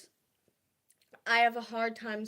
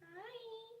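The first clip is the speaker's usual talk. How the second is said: pet talk; 11 semitones higher, 19 dB quieter than usual. Real, not acted. Greeting the cat.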